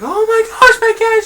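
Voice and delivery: high falsetto, mock wailing over a dead cat; hammed-up crying woman. Woman learns her cat was killed, mock sobbing in high falsetto. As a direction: play the cat owner hamming up her grief in a squeaky shriek. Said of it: "She starts crying".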